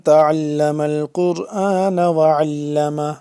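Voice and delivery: slow, loud, drawn-out recitation; measured tajweed chant; Arabic recitation. Real, not acted. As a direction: recite it with long held vowels. Opens lesson reciting an Arabic saying.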